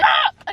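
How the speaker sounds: sudden high yelp of shock; quick startled shriek. Real, not acted. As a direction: yelp out in surprise. Sudden fright while driving.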